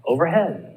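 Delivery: "Overhead" with HEAD lifted above "Over"; stress on last part. Shown as a demonstration. Teacher giving compound adverb examples, stress on HEAD in "Overhead". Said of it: "You're gonna stress the second syllable".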